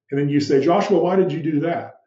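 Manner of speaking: ordinary delivery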